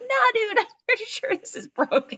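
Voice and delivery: high, laughing "Nah, dude"; retelling to friend, high and laughing; laughing insistence. Retold or imitated. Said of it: "I'm like".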